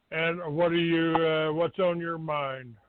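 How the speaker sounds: normal talking voice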